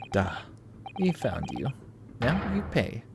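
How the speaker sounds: menacing mock Russian accent. Player voicing the Russian captor threatening in a Russian accent. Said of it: "That accent? Eastern European?".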